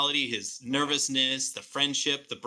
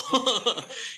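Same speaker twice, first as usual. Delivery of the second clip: laugh; 12 semitones higher than usual. Real, not acted. Laughs mid-quote about bad voice.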